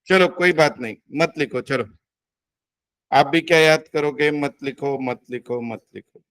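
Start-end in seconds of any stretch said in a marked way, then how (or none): 4.3-6.2 fading away with each repeat; dwindling into a murmur; resigned repetition. Real, not acted. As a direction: trail off softer and lower, giving up.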